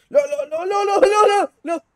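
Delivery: very high, loud string of cries; frantic high-pitched alarm; panicked shouting. Real, not acted. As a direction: scream it as you start to fall. About to fall in game.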